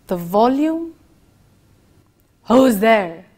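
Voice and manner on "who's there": louder, booming question. Shown as a demonstration. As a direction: call it out loud. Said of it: "We can change the volume".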